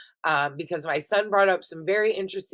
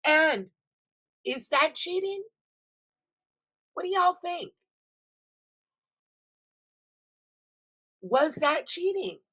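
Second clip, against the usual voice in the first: high teasing question; 10 semitones higher than usual. Real, not acted.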